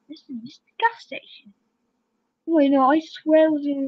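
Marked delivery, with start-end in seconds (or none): 0.1-1.5 soft secretive whisper. Pretend. Gamer sneaking viewers to the hiding spot, whispering. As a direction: hush it like you're hiding.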